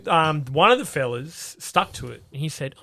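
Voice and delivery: ordinary talk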